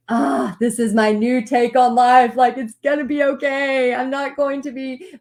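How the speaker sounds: retold burst of hopeful excitement; excited. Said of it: "And so I was excited".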